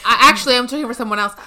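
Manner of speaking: a hint of a smug rival; loud, boastful dismissal; cocky rapper voice. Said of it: "That's him being like".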